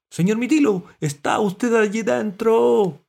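high, loud, drawn-out call; worried calling. A narrator's reading. Dog calling into the pool, worried. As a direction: call anxiously into the water. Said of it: "preguntó Galleta preocupado".

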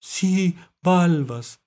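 high, loud character voice; mollusc confirming. A narrator's reading. Mollusc confirming the word, high and loud. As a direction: pipe up in agreement. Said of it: "dijo Mitilo".

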